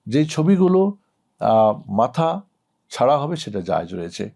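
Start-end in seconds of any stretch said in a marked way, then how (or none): none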